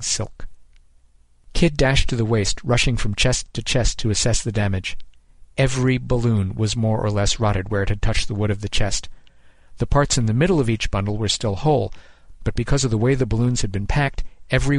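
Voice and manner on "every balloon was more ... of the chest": ordinary delivery, nothing marked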